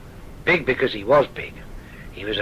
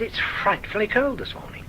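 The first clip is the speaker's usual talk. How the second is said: higher, refined southern English, repeated for a miner who didn't catch it; clear, polished southern English repeat; posh southern English; 10 semitones higher, 4 dB quieter than usual. Retold or imitated. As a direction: say it again in the parson's son's southern English. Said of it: "What did see?".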